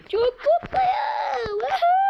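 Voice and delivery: slow, shrieking happy cheer; ecstatic squeal. Real, not acted. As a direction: scream with delight. Overjoyed with new house.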